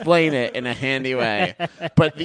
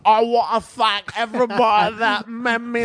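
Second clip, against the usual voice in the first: higher mock Cockney accent; a quick mock Cockney accent, pitched up; 10 semitones higher than usual. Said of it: "he is like Cockney".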